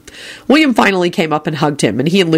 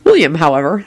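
ordinary delivery

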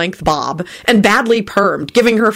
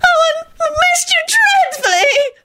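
gushing squeal; slower than usual. A narrator's reading. Loretta gushing after squeal.

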